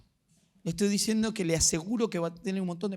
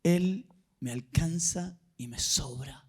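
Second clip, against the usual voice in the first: slow, weighty declaration; heartfelt testimony; 3 semitones lower, slower than usual. Real, not acted. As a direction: draw each phrase out with deliberate pauses. Testifies that God is enough.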